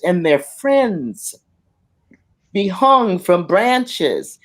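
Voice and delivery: very slow, heavy words; slow grave telling. Retold or imitated. Mother recalling lynchings to her children, very slow. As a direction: let each word fall slowly. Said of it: "She says".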